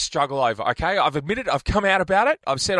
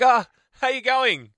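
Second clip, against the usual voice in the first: high-pitched cover for a forgotten name; bluffed greeting; 9 semitones higher, 4 dB louder than usual. Acted. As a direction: greet them brightly while hunting for the name. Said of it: "they have a crack at it".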